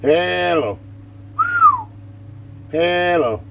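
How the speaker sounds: loud, steady radio check call; mic test call. Real, not acted.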